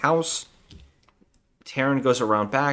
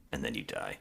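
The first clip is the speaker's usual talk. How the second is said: much lower and much softer, flat cheesy threat; muttered deadpan one-liner, lower and softer; deadpan movie villain; 14 semitones lower, 15 dB quieter than usual. Retold or imitated. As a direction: mumble it low to the camera like a cheesy villain. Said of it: "looks into the camera ... and he goes".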